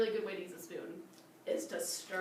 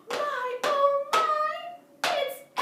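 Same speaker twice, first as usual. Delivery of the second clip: much higher gasping oh my; surprised; 9 dB louder, much slower than usual. Acted. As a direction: gasp in delighted surprise. Surprise at the door.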